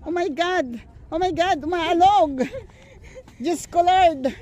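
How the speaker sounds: rising panic, high and loud; shrieking as the bridge sways; on a wobbly bridge. Real, not acted. Bridge shakes under her.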